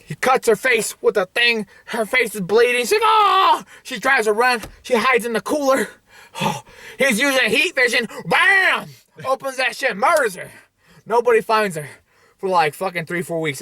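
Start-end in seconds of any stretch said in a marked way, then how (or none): 3.0-3.6 long high hammed-up cry; high mock scream. Waitress with her face bleeding, high mock scream. 8.2-8.9 high shouted bam. Acted. Kid using heat vision, shouted blast sound.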